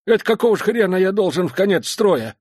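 put-on loud, high outraged old veteran; indignant old man. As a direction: protest indignantly like an old soldier. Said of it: "возмутился безногий".

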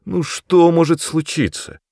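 high-pitched coaxing question; wheedling appeal; pleading. A narrator's reading. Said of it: "взмолился я".

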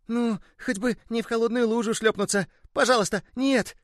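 high, pleading little ball voice; frightened little ball. Acted. A little ball begging mid-flight not to land in a puddle, high and pleading. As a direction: beg fate in a high little toy voice.

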